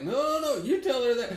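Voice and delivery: high, loud imitation of a buddy objecting; mock protest. Buddy on the phone bouncing it back, mock protesting. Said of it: "and he's like".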